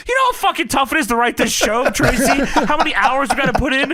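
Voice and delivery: high, loud preachy rant, half laughing; mock writers' mouthpiece. Tracy lecturing the Lauren character as a writer, high mock rant. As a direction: lecture like an overworked show writer. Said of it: "starts talking like she's the mouthpiece for the writers".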